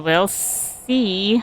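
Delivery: ordinary talk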